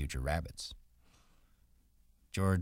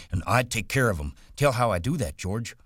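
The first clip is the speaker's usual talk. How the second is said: put-on higher and louder, childlike excitement; eager Lenny; 7 semitones higher, 6 dB louder, faster than usual. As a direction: plead to hear your favourite part. Lenny eager about rabbits.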